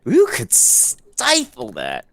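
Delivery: half-speed, stretching the start of "stifle"; drawn-out emphasis. Acted. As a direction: hold the "s" of "stifle" long and slowly. Repeats the line, exaggerating stifle.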